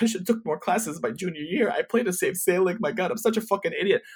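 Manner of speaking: high-pitched mock lament; self-berating regret. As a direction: whine at yourself in hindsight. Retold panicked regret, berating himself.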